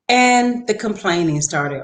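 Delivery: normal talking voice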